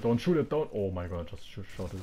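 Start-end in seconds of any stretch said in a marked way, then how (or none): 0.0-0.7 louder, higher pleading cry; urgent shout. Real, not acted. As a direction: yell to stop the shot. Urgently stopping a shot.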